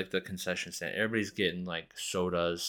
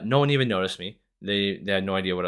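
usual speaking voice

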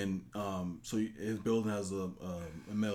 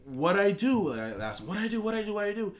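high, repeated frantic question; a scared kid pleading, pitched up; mock frantic pleading; 11 semitones higher, 5 dB louder than usual. Handcuffed kid pleading with the cop, high and frantic. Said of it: "I said".

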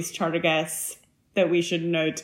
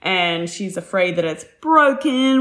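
normal talking voice

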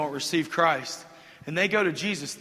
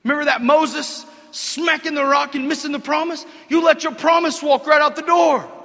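loud, high shout; full-force preacher shout; preaching shout; 9 semitones higher, 10 dB louder than usual. Real, not acted. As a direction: drive it home to the crowd. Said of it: "preaching shout".

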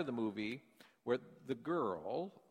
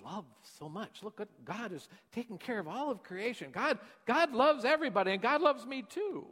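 rising much higher and louder to the end; girl's heartfelt belief; 9 semitones higher, 4 dB louder than usual. Retold or imitated. Seventh grade girl insisting God loves everyone, rising high. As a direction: swell with earnest conviction. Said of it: "he said".

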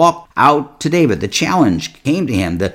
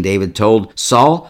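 ordinary talk

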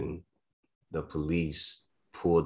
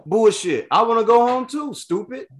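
much higher, louder, scornful retort; indignant outburst; 6 dB louder than usual. Real, not acted. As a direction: spit it back with real contempt. Real outburst at cops' excuse.

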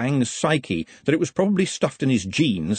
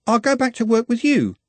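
put-on higher, eager girl's voice; young girl Juliet; 12 semitones higher, 3 dB louder than usual. Juliet insists to Glenda, high and eager. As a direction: voice her as a chatty young girl.

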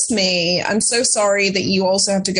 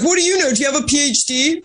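higher, mildly indignant mock voice; retold heated jab, pitched up; retold argument challenge; 6 semitones higher, faster than usual. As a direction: imitate an opponent's scornful challenge. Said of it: "got into a screaming match with".

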